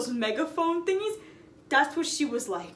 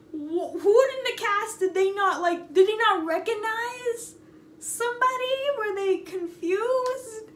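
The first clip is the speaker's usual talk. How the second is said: bewildered disbelief; 9 semitones higher than usual. Real, not acted. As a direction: marvel at how absurd it is.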